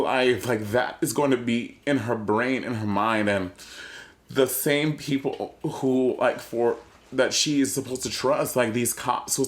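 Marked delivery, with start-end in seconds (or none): none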